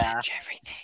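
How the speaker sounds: soft imitation of a whispering girl; hushed slogan voice-over; whispered game slogan. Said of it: "like, girl whispering".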